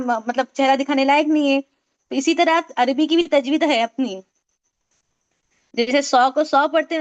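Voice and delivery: ordinary talk, nothing marked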